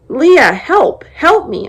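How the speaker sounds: high, loud desperate scream; trapped girl screaming to her sister outside; screaming for help. Acted. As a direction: scream her name for rescue. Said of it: "I screamed again".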